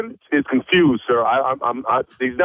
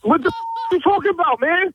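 exasperated; 11 semitones higher than usual. Real, not acted. Caller baffled by prank call.

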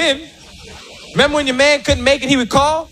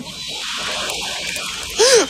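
wounded gasp; 12 semitones higher, 5 dB quieter than usual. Retold or imitated. Woman's shocked gasp.

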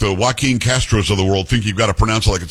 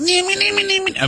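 mock piercing whine. Mocks piercing reporters' voices, very high.

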